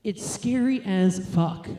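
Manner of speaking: ordinary talk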